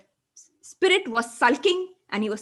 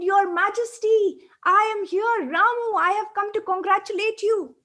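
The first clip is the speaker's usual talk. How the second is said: high, louder joyful calling out; delighted call; 8 semitones higher, 3 dB louder than usual. A narrator's reading. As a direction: hail the king from the crowd. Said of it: "He screamed in delight".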